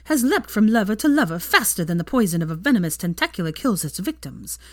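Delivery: arch tabloid columnist read; gossip column read. A narrator's reading. Narrator reads Rita's column to the readers, arch and gossipy. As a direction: read it like a smug society columnist.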